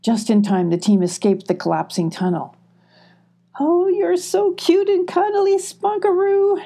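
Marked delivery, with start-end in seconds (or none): 3.6-6.6 high-pitched squeal over cute pets. A narrator's reading. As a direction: squeal with delight, much higher. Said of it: "squealed Molly".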